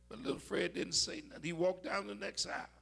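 ordinary talk, nothing marked

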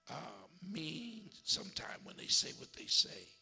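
usual speaking voice

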